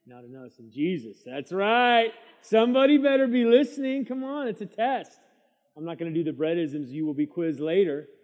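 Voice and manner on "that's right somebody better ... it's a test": high, loud call to the room; fired-up preacher. Real, not acted. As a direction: rouse the listeners. Preacher urging congregation to listen.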